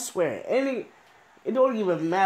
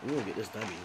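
low determined mutter; 8 semitones lower, 11 dB quieter, faster than usual. Real, not acted. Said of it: "I ain't even getting excited".

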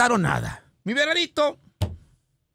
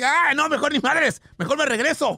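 mock backing out; 8 semitones higher than usual. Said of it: "dice así".

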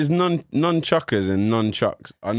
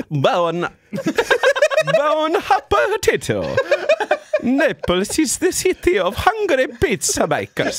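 put-on high, slow hammy Italian accent; hammed-up Italian chef accent; 3 dB louder, slower than usual. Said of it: "can you do an Italian accent?".

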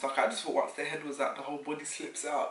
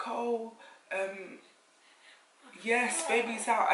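excited announcement; 7 semitones higher, slower than usual. Retold or imitated. Staff telling the mother her baby is out, excited. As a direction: announce the birth with joy. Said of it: "they were like".

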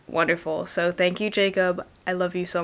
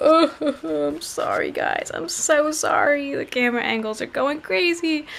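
high-pitched apology through giggles; laughing apology; 7 semitones higher than usual. Real, not acted. Apologizing mid-laugh over camera mishap.

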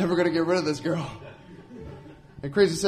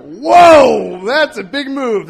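mock surprise; 5 semitones higher, 12 dB louder, much slower than usual. Reacting to prank.

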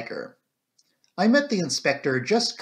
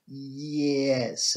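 doubtful. A narrator's reading. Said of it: "I said, rather doubtfully".

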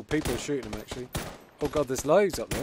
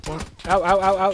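yelping ow; 6 semitones higher, 9 dB louder than usual. Real, not acted. Getting shot in game.

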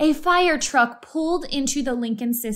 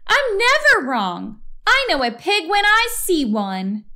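indignant shout; 8 semitones higher, 3 dB louder than usual. A narrator's reading. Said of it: "Eugenia shouted".

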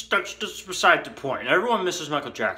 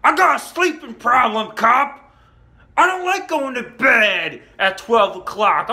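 loud, raised-pitch tirade; acted defiant rant; 9 semitones higher, 6 dB louder than usual. Refusing the cop's order to go inside, acted angry. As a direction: yell at the cop in sarcastic, hammed-up outrage.

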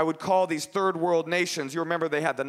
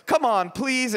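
high, needy begging; pleading; 9 semitones higher, 3 dB louder, slower than usual. Retold or imitated. Boy begging the operator, high pleading. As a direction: beg like a desperate kid.

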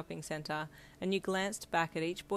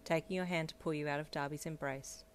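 plain reading voice

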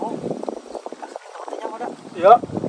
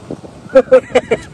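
high laugh; 6 dB louder than usual. Real, not acted. Bursts out laughing.

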